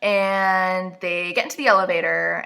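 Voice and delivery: ordinary talk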